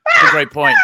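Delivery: high, loud excited exclamation; excited shout. Real, not acted. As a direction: cry it out with delight.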